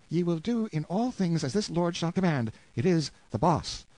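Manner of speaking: queen's command. Acted. Queen ordering her servants to obey the lord, regal high voice. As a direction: proclaim it like a haughty queen.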